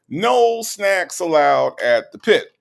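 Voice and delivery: loud, high, drawn-out mock ban; mock proclamation. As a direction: lay down the rule loudly and slowly. Mock rule announced to chat.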